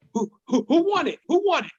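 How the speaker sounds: high, cocky challenge; challenging. Retold or imitated. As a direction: call out the neighborhood. Challenging neighborhood kids.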